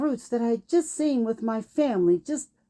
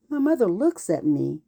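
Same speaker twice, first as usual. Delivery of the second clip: ordinary delivery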